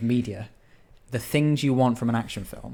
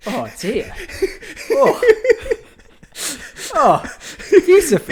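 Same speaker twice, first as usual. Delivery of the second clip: mock weeping; 7 dB louder than usual. Mock emotional over the film.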